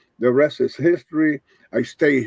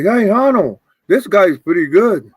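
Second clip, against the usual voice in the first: calling across the room, higher and louder; mock shout to a man playing cards; 5 semitones higher, 8 dB louder than usual. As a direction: promoter calling over to Arnold. Promoter calling out to Arnold.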